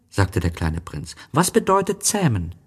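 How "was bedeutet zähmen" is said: put-on high childlike story voice; voiced little prince, lifted well up. A narrator's reading. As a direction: pitch it high for a small boy.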